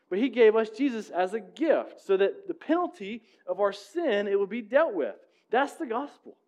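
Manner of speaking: lifted, fervent preaching voice; rising sermon. Acted. Preacher urging the congregation about grace, fervent. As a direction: drive the gospel point home with growing fervour.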